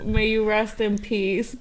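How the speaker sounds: soft slow mourning; solemn quiet. Real, not acted. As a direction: say it softly, grieving. Mourning a dead friend.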